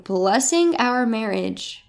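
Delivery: usual speaking voice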